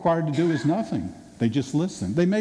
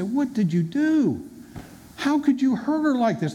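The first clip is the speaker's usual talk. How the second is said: light accusing friends, building higher; friends' reproach; 8 semitones higher than usual. Retold or imitated. Friends confronting the boyfriend.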